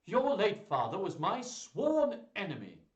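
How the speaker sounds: loud, high-pitched hostile duke; rasped. A narrator's reading. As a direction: rasp it out with open enmity. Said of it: "he rasped".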